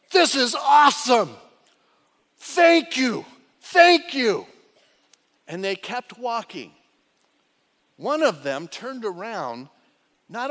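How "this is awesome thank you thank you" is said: loud, high, joyful shout; excited nine. Retold or imitated. Healed lepers cheering as they walk, loud joy. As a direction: burst out in thrilled thanks. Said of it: "the nine were like".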